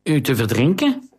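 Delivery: the accused man repeats the question in alarm, a touch of panic in his voice; half-voiced panic, disbelieving; panicked nasal man. A narrator's reading. Accused courtier to the queen, mildly panicked. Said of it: "De nasale stem klonk paniekerig".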